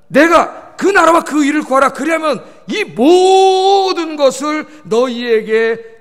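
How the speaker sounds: loud, high proclamation with long-drawn vowels; proclaiming scripture. Real, not acted. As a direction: declare the promise with a big stretched swell. Preacher proclaiming a scripture promise. (길게 늘여 힘차게 선포)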